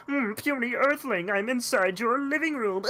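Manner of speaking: high, smug little alien menace; booming arrogant alien overlord. Retold or imitated. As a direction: play a gloating toy alien. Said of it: "he's like".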